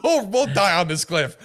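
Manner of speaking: a hint of a defiant Churchill through laughter; mock Churchill. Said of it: "Winston Churchill's like".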